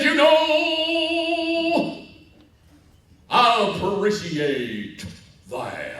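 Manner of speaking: booming sing-song preacher, long held vowels; slow, hammed-up pulpit delivery; Reverend Tucker. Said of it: "That's the way he thought".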